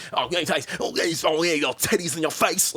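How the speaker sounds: lightly put-on rambling voice; imitating a random rambler. Imitating the nutty friend across the room, rambling. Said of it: "you just hear random conversations from him".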